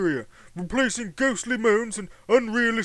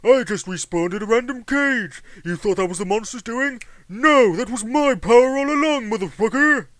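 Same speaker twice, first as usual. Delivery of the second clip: loud, higher taunt building to a shout; triumphant gloat; 3 semitones higher, 7 dB louder than usual. Acted. Respawned rival taunts the other, loud gloat. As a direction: crow over your rival.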